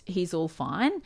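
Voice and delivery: ordinary delivery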